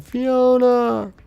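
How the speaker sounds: very high, loud, drawn-out "Fiona!" wail; crying after her. Retold or imitated. Retelling the Euro Trip breakup scene, mock anguished wail. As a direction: cry "Fiona!" after her as she walks away, hammed-up. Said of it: "he's, like, crying after her".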